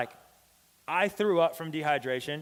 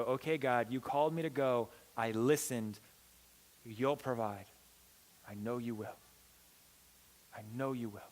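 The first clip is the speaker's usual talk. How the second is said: soft prayer; 3 semitones lower, 3 dB quieter than usual. Acted. Quiet prayer to God.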